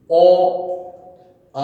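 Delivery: অ then আ drawn out, tongue pulled back; জিভ ভেতরে টেনে অ আর আ; vowel demo. Shown as a demonstration. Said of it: "দেখো দুটোই জিভ টানা". (পশ্চাৎ স্বর অ আ দেখানো)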